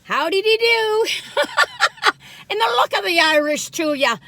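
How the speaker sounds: high, bright-eyed hammed-up Irish lilt; a playful stab at an Irish brogue; mock Irish accent. Greeting viewers in a put-on Irish accent. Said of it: "my little trying to be Irish accent".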